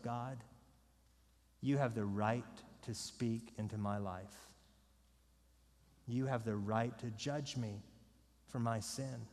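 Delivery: low, soft confession to God; humble, sincere prayer. Acted. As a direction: submit quietly, with pauses between phrases. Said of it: "do you humbly and sincerely say to yourself".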